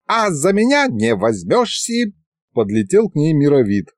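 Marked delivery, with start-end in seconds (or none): 0.1-2.1 put-on higher, teasing old ghost; old ghost wizard. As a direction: pipe up like a cheeky old wizard. (озорной старик-призрак, выше обычного)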